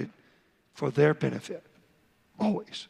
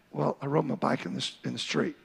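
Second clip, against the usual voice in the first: put-on a low, hesitant little boy's voice; lightly voiced child confessing to his father; sheepish child; 4 semitones lower, 4 dB quieter than usual. Shown as a demonstration. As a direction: mumble it like a guilty son.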